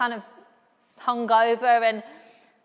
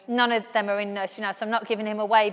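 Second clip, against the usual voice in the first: ordinary talk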